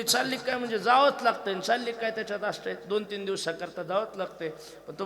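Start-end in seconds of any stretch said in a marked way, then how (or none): 0.0-1.5 raised, exasperated wife's reply; sharp retort. Retold or imitated. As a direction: snap back at the husband, louder. Wife retorts to crying husband. (बायकोचा चिडका, मोठ्या आवाजातला जवाब)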